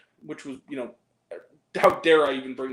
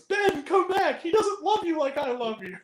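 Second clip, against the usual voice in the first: much higher, desperate mock plea; pleading Kimmel; 12 semitones higher, 3 dB louder than usual. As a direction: beg Ben to come back, hammed up. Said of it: "and he goes".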